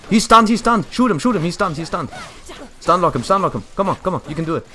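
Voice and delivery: excited shouted callouts; urgent, loud teammate cheering; cheering someone on. Real, not acted. Cheering teammates in boss fight.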